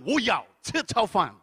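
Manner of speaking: forceful dubbed-movie delivery, slowed; action-film Mandarin. Retold or imitated. Diner ordering as in last night's dubbed film, forceful and slow. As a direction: punch it like a dubbed action hero. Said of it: "bermodalkan nonton Fast and Furious".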